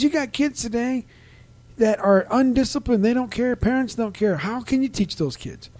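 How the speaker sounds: put-on pitched well up, griping about undisciplined kids; hammed-up grumbling old fireman; fed-up neighbor.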